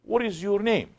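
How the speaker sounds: high, kindly questioning voice; nice voice. Retold or imitated. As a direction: imitate a sheikh sweetly asking a name. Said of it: "with a nice voice saying".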